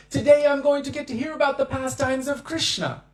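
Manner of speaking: excited. Retold or imitated. Devotee thrilled a preacher came to speak, excited. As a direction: gush like a devotee who finally gets the treat. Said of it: "they would be so excited".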